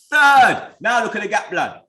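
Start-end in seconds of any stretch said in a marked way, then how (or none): 0.1-0.7 a loud, high one-word jeer; taunting shout. Real, not acted. Fan rubbing Chelsea's third place in rivals' faces, loud jeer. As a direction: bark it at rival fans like a final verdict.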